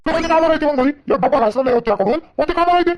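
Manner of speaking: high, exasperated questioning; scornful challenge. Acted. Husband asking wife what housework she has, scornful and angry.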